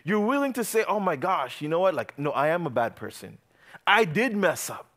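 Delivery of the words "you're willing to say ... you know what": ordinary talk, nothing marked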